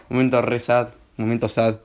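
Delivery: normal talking voice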